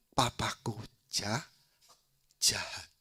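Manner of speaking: slow, near-whispered accusation; dramatized quiet anguish; acted hurt. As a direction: let it out in a broken-up hush. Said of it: "Dia nangis, dia nangis".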